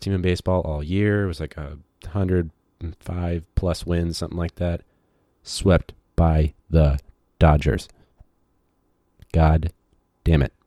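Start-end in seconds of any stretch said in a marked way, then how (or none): 5.4-10.5 frustrated. Real, not acted. Venting about Cubs sweep.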